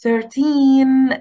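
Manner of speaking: put-on Thirteen with the weight on "teen"; stress on thirteen's second syllable. Shown as a demonstration. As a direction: lean on the second half of Thirteen. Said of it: "الاسترس فيها على المقطع التاني". (الضغط على المقطع التاني في Thirteen)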